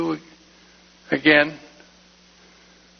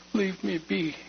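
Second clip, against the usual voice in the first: choked plea; 3 semitones higher, 8 dB quieter than usual. Retold or imitated. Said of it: "came out".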